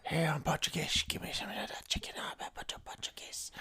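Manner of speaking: exaggerated Portuguese accent, partly whispered; mock a Portuguese customer in a heavy accent. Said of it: "Why were you doing, like, a Korean accent?".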